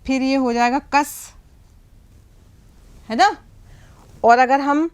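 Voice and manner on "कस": कस said as "kas" with an open short a; short a vowel. Shown as a demonstration. Teacher showing students the zabar reading, kas. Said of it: "इसको लगा देंगे इसके ऊपर ज़बर".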